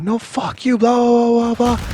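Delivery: very high, mocking shout; mock his screaming, dissolving into loud babble; mimicked screaming. Celebrity screaming at the guard, mimicked high shout. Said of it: "he kept on screaming".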